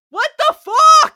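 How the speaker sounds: high, loud disbelieving yell; shocked outburst. Retold or imitated. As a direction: shriek it like you can't believe your ears. Narrator reacting in disbelief.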